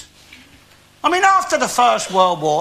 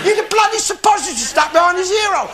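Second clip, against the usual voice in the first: shouted retort; 9 semitones higher, faster than usual. Retold or imitated. Outburst in joke.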